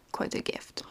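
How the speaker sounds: breathy whisper. Real, not acted. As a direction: whisper it as a private aside. Intimate aside about motherhood.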